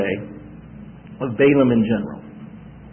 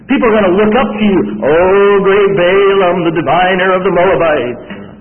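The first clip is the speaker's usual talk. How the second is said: loud, grand proclamation of praise; King Balak's flattery; 3 semitones higher, 5 dB louder than usual. Acted. King Balak flattering Balaam the diviner, grandly acclaiming. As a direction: hail him like a celebrated hero. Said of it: "So now he's saying".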